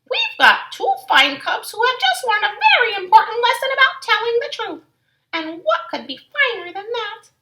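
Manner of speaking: Mama praising her cubs; proud Mama. Acted. Mama answers fondly.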